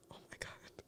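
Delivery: barely-there whispered gasp; hushed awe. Retold or imitated. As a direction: whisper it as if overcome, retelling the moment. Retold awed reaction to touching line.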